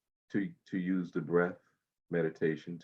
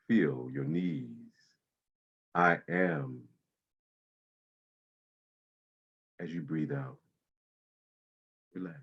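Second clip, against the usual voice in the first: guided meditation. Acted. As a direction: ease them into calm breathing. Guides body-scan meditation.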